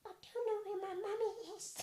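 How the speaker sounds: put-on very high, soft lost-child voice; little gremlin child in falsetto; softened small creature. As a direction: plead like a tiny lost creature. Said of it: "he just softens his voice".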